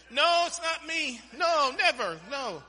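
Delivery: high, loud protesting denials; frantic denial. Acted. Preacher plays Peter denying he knows Jesus, frantic protest. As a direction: deny it hard, pitch leaping then dropping off.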